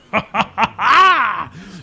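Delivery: big, booming hearty laugh, high and loud; a warrior's loud belly laugh echoing down the stairs; boisterous laugh. Acted. Armored warrior entering the tavern, loud booming laugh. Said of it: "you hear a boisterous laugh".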